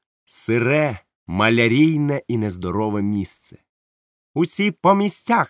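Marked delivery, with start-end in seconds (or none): 4.3-5.5 high, loud command to the crew; captain's order. Acted. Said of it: "сказав капітан".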